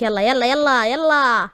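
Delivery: slow, drawn-out mock shooing; lightly acted send-off, stretching each word; dismissive shooing. Mother sending father off to his family, dismissive shooing.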